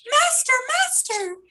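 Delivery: very high thin call for help; harp calling out. A narrator's reading. The harp crying for its master, very high. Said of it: "the harp called out".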